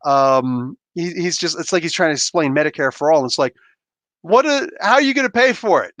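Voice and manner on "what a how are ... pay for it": high-pitched, incredulous mimicry; mock objection. Heckler at someone explaining Medicare for all, high mock indignation. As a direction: imitate a squawking objector.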